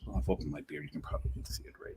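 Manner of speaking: soft unvoiced whisper. Real, not acted. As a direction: whisper it to the camera. Shows beer on camera.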